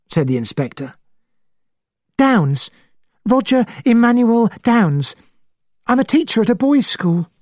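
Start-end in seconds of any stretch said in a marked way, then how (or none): 2.2-7.3 high-voiced schoolteacher; excitable witness, pitched up; excited, high. A narrator's reading. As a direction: give particulars in an agitated high voice. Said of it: "He spoke in an excited, high voice".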